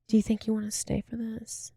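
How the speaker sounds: mock-meek little request; imitated timid question. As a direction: ask it small and delicately, as an impression. Acting suspect sweetly asking her companion.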